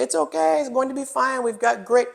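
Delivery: lightly voiced high Sherpa accent, reassuring; a hint of a Sherpa guide, high and soothing. Sherpa guide reassuring climbers at the ladder, light high accent. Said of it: "I don't know the accent".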